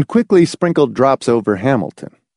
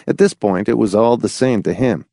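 ordinary delivery

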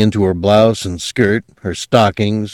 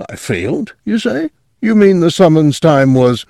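rising, incredulous question; disbelieving; 9 semitones higher than usual. A narrator's reading. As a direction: ask in dismay.

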